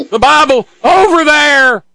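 loud, very high pulpit shout; hammed-up preacher's holler; mock preacher. Mimicking the preacher's clip.

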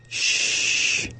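soft hushing whisper; whispering over a crib. Retold or imitated. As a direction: hush it like soothing a baby. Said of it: "Just like the woman in the ... ad says".

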